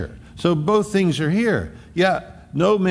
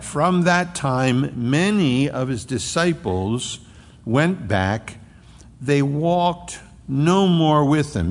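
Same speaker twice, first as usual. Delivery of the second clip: slow, weighty reading of the verse; slow scripture reading; slower than usual. Acted. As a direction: lay each phrase down slowly, solemn. Reading scripture aloud to congregation.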